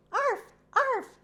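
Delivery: high, yappy little puppy arf; high squeaky puppy barks. A narrator's reading. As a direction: yap it out like an excited puppy. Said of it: "barked the puppy".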